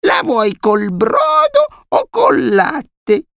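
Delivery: very high, slow toothless granny; mumbling old granny. A narrator's reading. Deaf grandmother asking grandson about soup, high slow mumbling. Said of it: "chiese biascicando la nonna".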